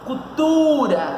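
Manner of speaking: Cultura with a long, high TU; stressed syllable stretched. Shown as a demonstration. Teacher showing where the tonic syllable is, Cultura with TU stretched. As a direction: lean hard on the TU in Cultura, held long. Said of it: "Qual é a posição da sílaba tônica?".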